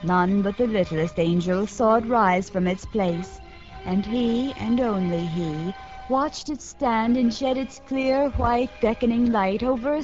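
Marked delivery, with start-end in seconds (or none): none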